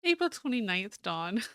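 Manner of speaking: put-on British-accented journal date, falling softly; fancy British accent. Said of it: "Fancy British".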